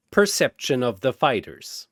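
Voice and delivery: ordinary delivery, nothing marked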